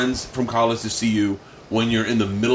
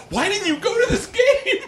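put-on much higher kid voice, laughing; the child asks dad innocently; high childlike question; imitating son. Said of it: "George goes".

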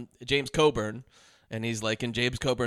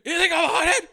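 very high, loud, crazed mock rage; hammed-up hysterical fury; imitated meltdown shouting; 7 dB louder, faster than usual. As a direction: scream each line, louder as it goes. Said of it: "and I'm not exaggerating".

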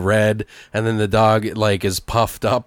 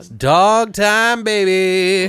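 singsong tease; 8 semitones higher, 4 dB louder, much slower than usual. Pretend. Teasing singsong over dog argument.

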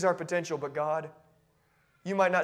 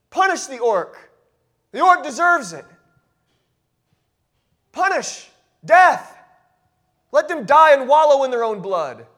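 mock harsh verdict; 8 semitones higher, 12 dB louder than usual. Voicing harsh judgment on orcs.

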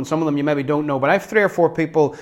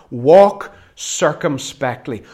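"Walk circumspectly" slow and emphatic; savoring old word; 5 dB louder, much slower than usual. Shown as a demonstration. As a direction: dwell on "Walk circumspectly" slowly with weight. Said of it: "this wonderful old word".

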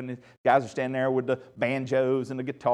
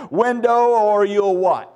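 slow, daring "or you'll what"; mock defiant comeback; 4 semitones higher, 5 dB louder, slower than usual. Laughing at the check-in girl's threat, slow mock defiance. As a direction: call her bluff, slowly. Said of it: "I laughed in her face and replied".

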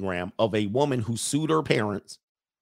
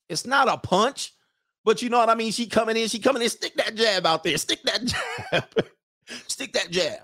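excited; 10 semitones higher than usual. Real, not acted. Explains jab excitedly.

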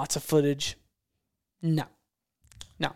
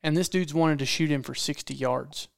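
ordinary delivery, nothing marked

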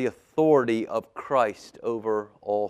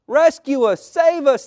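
very high, loud shrieking; shrieked plea; 14 dB louder, slower than usual. A narrator's reading. Fishermen begging Jesus for rescue, shrieked. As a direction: shriek desperately to be saved. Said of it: "they shrieked".

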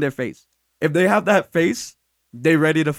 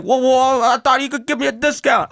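retold dad's raised-voice protest; mock indignant protest; 5 semitones higher than usual. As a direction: play an affronted customer protesting. Retold dad protesting to son.

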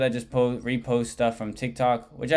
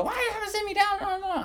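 put-on very high, whiny child's protest; imitated squealing tantrum; screaming kid. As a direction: wail like a kid put in time-out. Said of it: "he starts screaming and crying even more".

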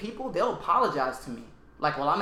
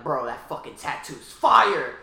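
slow, drawn-out hype compliment; hyped compliment; 6 dB louder, much slower than usual. Retold or imitated. To a stranger on the train, drawn-out hyped compliment. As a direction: gas up the stranger's tattoo.

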